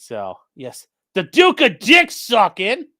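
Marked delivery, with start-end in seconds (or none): 1.1-2.9 loud, slow, higher mock herald; mock proclamation. Mocking a guy by crowning him Duke, slow loud proclamation. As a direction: proclaim it like a royal title.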